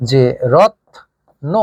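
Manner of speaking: রত্ন said very slowly, t joined to n with no vowel; slow demo pronunciation. Shown as a demonstration. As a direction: spell out রত্ন slowly as rotno. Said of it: "উচ্চারণ কিন্তু ছিল এমন".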